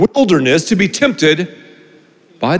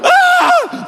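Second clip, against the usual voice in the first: high shrieking yelp of pain; mock scream. Boy bitten by the snake, high mock scream. Acting out the bite.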